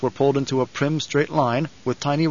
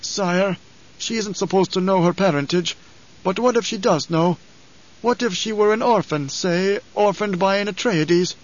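put-on higher-pitched old man, speculating; raised, careful voice of an elderly aide; insinuating old advisor; 6 semitones higher than usual. A narrator's reading. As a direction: cautiously float a suspicion to your lord.

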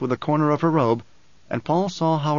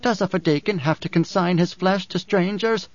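higher character voice, pointed question; Dory, reproachful woman; 5 semitones higher than usual. Acted. Dory berates Paul for his callousness, reproachfully.

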